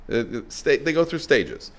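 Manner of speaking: usual speaking voice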